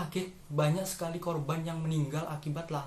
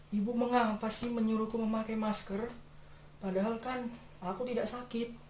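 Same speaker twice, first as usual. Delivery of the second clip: put-on high-pitched, plaintive child voice; complaining child; 7 semitones higher than usual. Child complains about mother.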